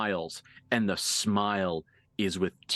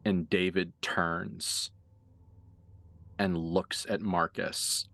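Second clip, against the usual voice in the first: game master narration; 3 semitones lower than usual. Acted. GM describes two unhumans recognising each other, low suspenseful narration. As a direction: let each image hang, low and measured. GM's hushed scene setting.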